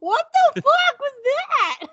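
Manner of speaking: very high-pitched retold disbelief; mock startled. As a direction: replay your shock, voice shooting way up. Acts out own startled reaction.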